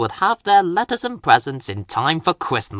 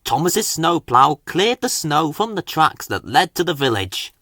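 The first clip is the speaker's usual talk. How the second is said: plain reading voice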